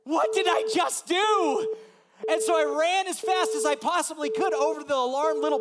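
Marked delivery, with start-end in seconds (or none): 0.0-1.8 loud, high, slow panic; higher, louder horrified cry; panicked shout. Retold or imitated. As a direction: blurt it out in alarm. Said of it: "I'm like".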